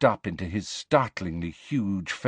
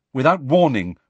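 ordinary delivery

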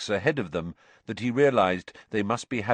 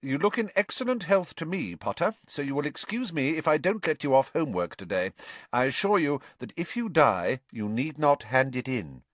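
higher-pitched, businesslike teacher; matter-of-fact; 6 semitones higher than usual. A narrator's reading. Teacher excusing nobody from homework, matter-of-fact. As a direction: deliver it dryly as classroom business. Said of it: "in a very matter-of-fact tone".

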